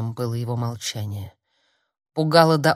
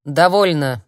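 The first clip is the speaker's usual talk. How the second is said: loud, impatient command; out of patience; 7 dB louder than usual. A narrator's reading. Mentor halts the healer's vain efforts, out of patience. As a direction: put a stop to it, patience gone. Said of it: "У Ихтера иссякло терпение".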